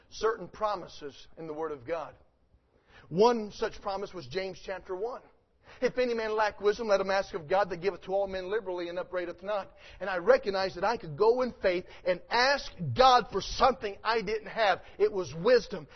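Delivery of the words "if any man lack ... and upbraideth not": quick recitation. Acted. Quoted verse recited quickly.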